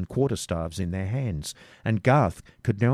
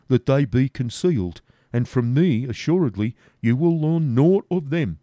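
higher, louder refusal; stubborn Garth; 5 semitones higher, 5 dB louder than usual. Acted. Garth refuses the robber, higher and louder. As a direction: dig in and refuse.

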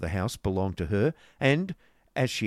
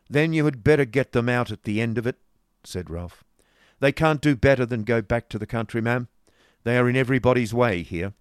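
put-on louder, brusque old moneylender; stern businessman; 4 semitones higher, 7 dB louder than usual. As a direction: lay it down hard and brusque. Ralph voiced through dialogue.

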